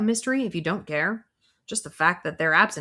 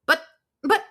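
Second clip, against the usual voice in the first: high, louder sputtering; flustered; 11 semitones higher, 5 dB louder than usual. Acted. Flustered colleague sputtering at the empty room, high and loud. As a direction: stammer it out, flustered. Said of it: "totally flustered".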